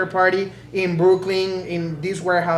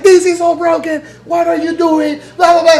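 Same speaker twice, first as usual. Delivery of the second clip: loud, high-pitched scolding complaint; bad cop manager; 10 semitones higher, 8 dB louder than usual. Manager comes in as bad cop, loud mock outrage. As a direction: act out an outraged manager berating the crew. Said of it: "the good cop, bad cop".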